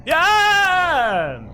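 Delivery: triumphant yelp. Real, not acted. Gamer yelling as the drifter kills him as predicted. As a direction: shout it out as you're proven right.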